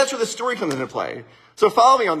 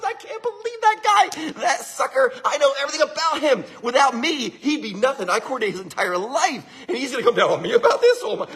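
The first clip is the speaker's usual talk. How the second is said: hitting the ceiling; 2 semitones higher than usual. Acted. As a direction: rant as an indignant secretary. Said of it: "She hit the ceiling".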